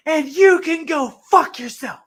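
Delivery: 学店员鼓起勇气冲着门口大吼; imitated parting yell. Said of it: "as loud as he can fucking say it".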